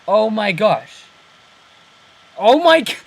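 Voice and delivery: building amazement at the discovery; excited realisation. Real, not acted. Realising a comic twist.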